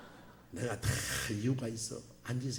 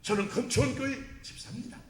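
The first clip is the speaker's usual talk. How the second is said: proud self-introduction; 7 semitones higher than usual. Retold or imitated. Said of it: "누가 만나면".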